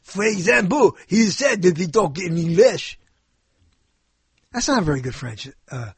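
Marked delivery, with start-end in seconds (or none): 0.0-0.9 put-on French accent; mock French-accented English. Shown as a demonstration. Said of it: "this is a French guy". 1.1-3.0 put-on French accent; mock French-accented English. Shown as a demonstration. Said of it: "this is a French guy".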